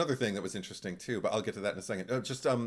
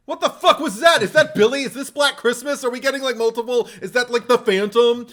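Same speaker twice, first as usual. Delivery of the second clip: high, loud incredulous yelling with laughter; baffled outburst; 13 semitones higher, 13 dB louder than usual. Retold or imitated. Viewer acting out hearing the strange voice in the film, loud disbelief. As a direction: fire off shocked questions at full volume.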